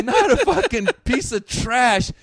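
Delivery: loud, high, drawn-out scolding, half laughing; exasperated mock outburst. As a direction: hammer it out slowly as a comic outburst. Acted exasperation at a drunk heckler.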